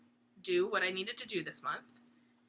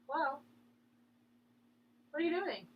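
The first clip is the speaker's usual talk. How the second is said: high sing-song voice for the dog; pet talk voice; 6 semitones higher than usual. Real, not acted. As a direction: coo at the dog. Talking to her dog.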